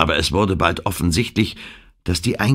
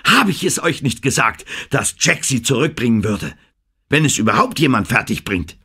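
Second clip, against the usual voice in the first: shouting; 5 semitones higher than usual. A narrator's reading. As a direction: crow it at the crew. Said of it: "brüllte er".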